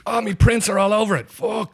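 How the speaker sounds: high, alarmed outburst; mock panic. Irishman tricked into holding the gun, mock panic. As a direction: realise you've been framed and yelp. Realises he has been framed.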